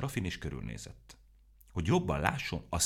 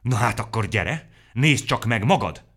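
loud, higher shouted challenge; shouting; 6 semitones higher, 11 dB louder than usual. A narrator's reading. Aromó shouting at Mikka Makka to come and see, loud. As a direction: call him over in a heated shout. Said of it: "kiabált neki vörösödve".

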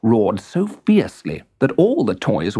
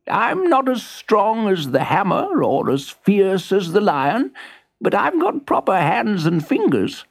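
put-on higher, gentle old monkey voice; 9 semitones higher than usual. Old monkey offering to help the toys, higher. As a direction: speak modestly like an old toy monkey. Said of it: "he climbed down from the shelf and said".

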